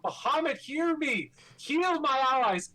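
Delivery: high, loud holy invocation; calling out. A narrator's reading. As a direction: cry out to a god for aid. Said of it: "he calls out".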